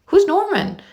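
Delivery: put-on a boy asking who that is; curious friend. A narrator's reading. Friend Austin asks, puzzled and high.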